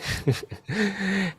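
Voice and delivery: light, real laugh to oneself; short chuckle. Real, not acted. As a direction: let out a brief chuckle. Laughs after naming countries.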